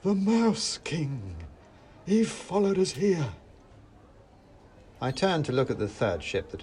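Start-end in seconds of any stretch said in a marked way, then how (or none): 0.0-3.3 high, dramatic dread; high-pitched, drawn-out horror; horrified. A narrator's reading. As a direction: announce the villain in alarm. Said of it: "with a shaking finger".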